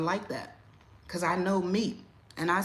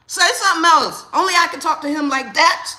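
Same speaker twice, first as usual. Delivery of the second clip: high, loud protective threat; loud warning; 10 semitones higher, 12 dB louder than usual. Retold or imitated. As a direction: dare them to say one more word. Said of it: "Say something else to my father".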